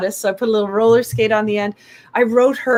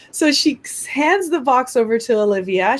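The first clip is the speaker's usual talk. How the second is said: ordinary talk, nothing marked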